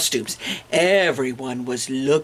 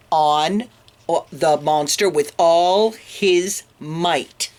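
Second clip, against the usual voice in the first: usual speaking voice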